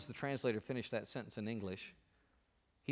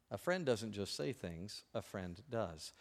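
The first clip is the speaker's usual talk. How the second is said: quieter, slower echo; soft repetition; 6 semitones lower, 10 dB quieter, slower than usual. Real, not acted. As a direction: repeat it quietly to sink in. Repeated for emphasis.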